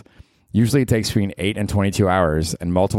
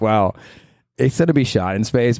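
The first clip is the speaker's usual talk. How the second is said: ordinary talk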